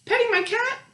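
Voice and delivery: high, outraged question; a neighbor squeaking in disbelief; indignant neighbor. Acted. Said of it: "this person goes".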